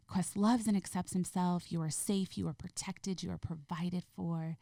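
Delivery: ordinary talk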